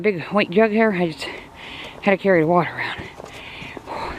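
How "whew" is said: soft voiceless whew of an exhale; breathless whew. Real, not acted. Puffing after lugging water around, breathless exhale. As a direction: blow the air out, spent. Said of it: "I'm out of breath".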